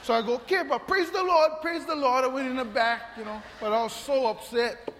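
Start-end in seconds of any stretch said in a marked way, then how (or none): 0.3-2.2 higher, louder strained praise the Lord; forced praise. Retold or imitated. Pastor biting back anger at the cutter, forced praise. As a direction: swallow anger and praise through it. Forcing calm instead of grumbling.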